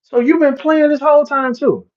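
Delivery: a startled, high-pitched "you too?"; shocked realization. Retold or imitated. Realizing his friends played him too, surprised. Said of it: "and I'm like".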